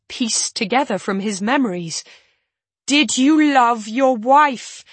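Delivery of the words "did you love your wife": loud, high, drawn-out demand; shout. A narrator's reading. Narrator begging Yarrick to answer, shouted. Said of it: "I shout this time".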